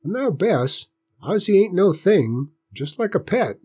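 put-on high old country man soothing; coaxing old grandpa. A narrator's reading. Grandpa reassures Bess about Ozzie, old country voice. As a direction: gently talk her round like an old farmer.

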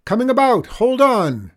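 high, loud warning shout. A narrator's reading. Chester steering through the ice wall opening, shouting. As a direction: shout both commands at full voice. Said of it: "he shouted".

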